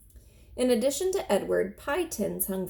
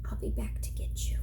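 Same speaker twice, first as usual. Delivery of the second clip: soft whispered promise; boy whispers a promise to the rabbit; 4 dB quieter than usual. Acted. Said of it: "he said to Edward".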